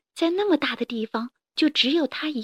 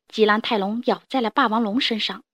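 plain reading voice

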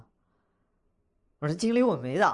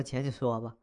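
soft, lowered, submissive reply; meek backing down; 4 semitones lower, 7 dB quieter than usual. Acted. Host yields to the client who just yelled, soft and meek. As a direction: give in quietly, voice dropped.

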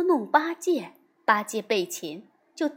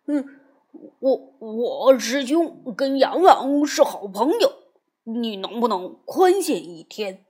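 scared stammering plea, louder; flustered pleading; 5 dB louder than usual. A narrator's reading. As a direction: beg for time in a panic. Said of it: "八戒慌了".

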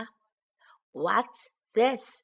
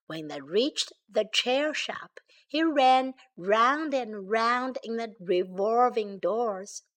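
usual speaking voice

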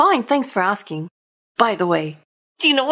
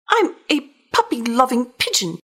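usual speaking voice

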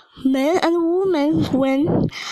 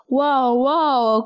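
understated growl, barely roughened; mild growl. A narrator's reading. The angry spoon after the crash, mildly growling. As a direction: grumble it lightly, as a grumpy spoon. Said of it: "growled the spoon".

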